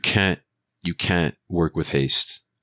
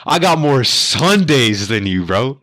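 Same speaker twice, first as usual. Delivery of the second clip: higher, louder, slow triumphant put-down; boastful comeback; 8 semitones higher, 6 dB louder, slower than usual. Retold or imitated. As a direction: savor the win, slowly. Dad's comeback to the trucker.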